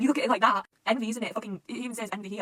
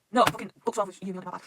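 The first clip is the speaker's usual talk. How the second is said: usual speaking voice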